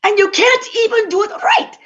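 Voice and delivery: high mock scolding; lightly voiced scolding husband. Husband berating wife.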